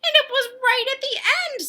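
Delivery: very high chicken voice. Acted. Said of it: "said Chicken".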